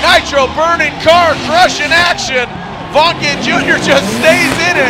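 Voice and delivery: loud, high hype announcer; monster truck ad. Real, not acted. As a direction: sell it like an ad. Hyped play-by-play call.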